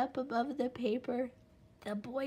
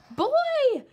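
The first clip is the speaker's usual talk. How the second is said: high, loud yell; cry out; 13 dB louder than usual. A narrator's reading. Little Wolf calling his parents, loud high yell. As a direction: yell to get them running. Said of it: "he cried".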